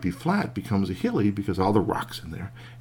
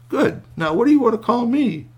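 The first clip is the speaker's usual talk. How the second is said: eager high; 13 semitones higher, 6 dB louder than usual. Acted. Man asks bear for name.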